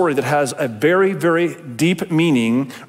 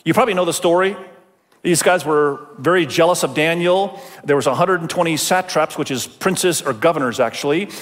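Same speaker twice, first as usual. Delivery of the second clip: ordinary talk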